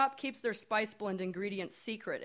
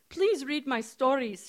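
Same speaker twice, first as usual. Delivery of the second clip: put-on slow pleading Indian accent, pitched up; 5 semitones higher, 6 dB louder than usual. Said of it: "she says in her strong Indian accent".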